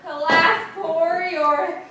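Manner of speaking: loud, projected Shakespearean lament; grand tragic proclamation; theatrical declamation. Acted. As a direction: declaim it like a stage Hamlet. Declaims Hamlet to the skull.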